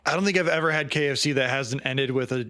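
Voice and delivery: ordinary delivery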